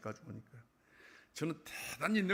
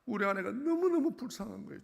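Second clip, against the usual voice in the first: choked-up outcry; 11 semitones higher, 8 dB louder than usual. Real, not acted. Recalling dying wife.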